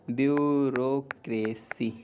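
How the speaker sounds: "Bureaucracy" drawn out very slowly; slow careful demo. Shown as a demonstration. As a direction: say "bureaucracy" slowly so they catch it.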